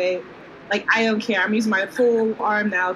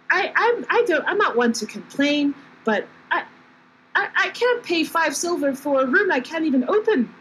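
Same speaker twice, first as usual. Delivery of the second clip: higher, stammering protest; put-out guest stumbling over objections; flustered complaint; 6 semitones higher than usual. Acted. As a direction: complain hesitantly, pitched up. Flustered guest complains about broken door.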